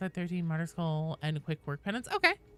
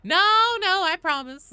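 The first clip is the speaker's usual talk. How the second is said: high, loud pleading no; earnest protest to chat; insistent protest; 13 semitones higher, 10 dB louder than usual. Real, not acted.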